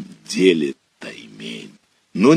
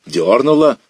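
raised, louder, a first excited cry; boy feels a bite on the line, excited cry; 5 semitones higher, 6 dB louder than usual. Acted. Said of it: "Голос задребезжал, сорвался".

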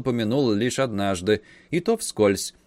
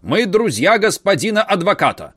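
loud, high shout; 9 semitones higher, 7 dB louder than usual. A narrator's reading. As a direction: yell it at the door. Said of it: "крикнул дядя".

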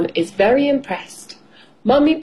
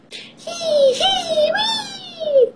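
very high, drawn-out sliding squeal; gleeful squeal; much slower than usual. A narrator's reading. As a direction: squeal like a child whizzing down a slide. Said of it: "cries George, sliding all the way down".

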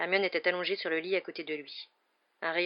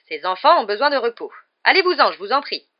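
high, loud insistent plea; insist, out of patience; exasperated plea; 6 semitones higher, 12 dB louder than usual. A narrator's reading. Nurse orders visitors out. (prière exaspérée)

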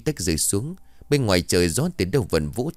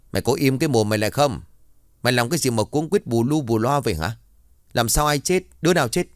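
snapping angrily; 5 semitones higher, faster than usual. Acted. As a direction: bark at the maid to shut up. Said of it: "ông liền gắt giọng". (gắt gỏng quát mắng)

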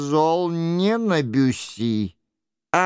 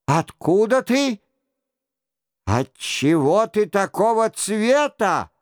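astonished, higher-pitched questions; exclaiming; 5 semitones higher than usual. A narrator's reading. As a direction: demand an explanation in amazement. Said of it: "вскричал король". (изумлённые восклицания)

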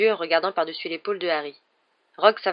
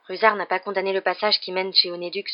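ordinary delivery

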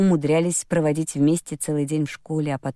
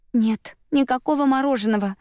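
sighing mother; 7 semitones higher than usual. A narrator's reading. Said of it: "вздохнула Кэтрин".

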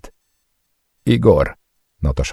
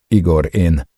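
trembling voice; 4 semitones higher, 3 dB louder than usual. A narrator's reading. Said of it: "A hangja remeg".

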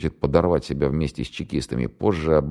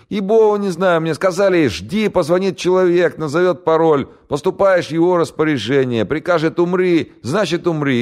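put-on much higher, louder panicky young man; scared radio operator; 14 semitones higher, 5 dB louder than usual. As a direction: blurt it out in terror. Голос радиста. (испуганный пленный радист, высоко и громко)

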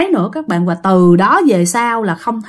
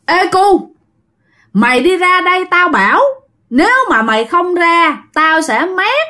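put-on higher-pitched voice of a threatening young man; người thanh niên hăm dọa; 7 semitones higher than usual. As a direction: lightly act out a stranger calling a kid out. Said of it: "Người này kêu rằng". (giọng thanh niên gọi ra hăm dọa, hơi cao)

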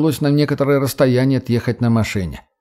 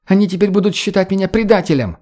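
upset cry; 8 semitones higher than usual. A narrator's reading. Redhead to her captor at the halt, agitated cry. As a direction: protest in a high, agitated voice. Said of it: "кричала рыжеволосая".

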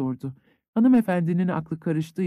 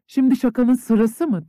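openly annoyed; 6 semitones higher, 4 dB louder than usual. A narrator's reading. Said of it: "canının sıkıldığını gizlemeden".

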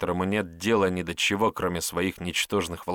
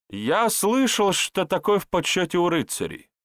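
much higher, eager herbalist; eager excuse; 11 semitones higher, 3 dB louder than usual. Acted. Herbalist explaining his praise of the blow, high-pitched and eager.

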